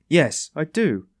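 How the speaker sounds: slow insistent investigator. A narrator's reading. Porphyry insists slowly.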